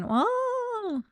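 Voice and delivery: very high, long mock ghost moan; hammed-up ghost moan; ghostly wail. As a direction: wail like a ghost haunting the hall. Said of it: "sounded like a bunch of ghosts".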